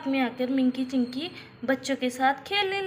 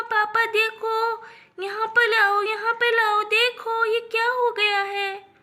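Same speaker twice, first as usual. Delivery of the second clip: put-on high, loud childlike lisping cries; upset little girl; 6 semitones higher, 5 dB louder than usual. A narrator's reading. Small girl crying out to her parents for help. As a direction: beg for help for the dog.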